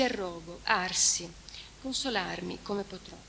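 a mournful recitation of the Trento lament; solemn reading of a lament. Acted. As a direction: read it like a mourning poem. Reads the lament translation aloud.

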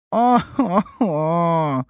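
slow drawn-out moaning "о-хо-хо"; groan. A narrator's reading. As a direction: groan long and pitiful. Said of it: "простонал жердяй".

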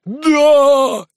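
high, loud roar of assent; long, wild cheer; frenzied crowd yell. A narrator's reading. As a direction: bellow yes like a raging mob. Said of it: "бесновалась толпа".

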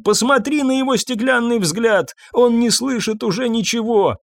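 high-pitched distraught cry; screaming. A narrator's reading. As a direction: cry out in anguish, voice pitched right up. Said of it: "кричала Ирен".

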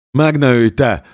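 high, loud terrified plea; a monk in a chokehold babbles for mercy, high and loud; frantic blurt. A narrator's reading. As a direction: blurt it out in panic, begging for life.

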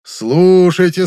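high, very slow drawn-out call; town crier. A narrator's reading. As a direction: stretch the call out to the crowd. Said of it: "глашатай, обязанный объявлять публике".

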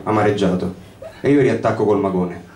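plain reading voice

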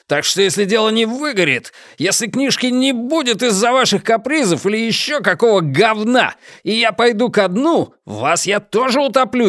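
swearing like a cabman. Acted. Publisher threatening the writer over the book, heated swearing. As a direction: swear at him like a cabman, pitch up high. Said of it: "начал просто ругаться, как извозчик".

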